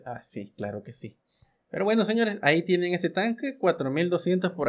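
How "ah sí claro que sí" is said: sarcastic mutter. Real, not acted. Mocking reply to himself.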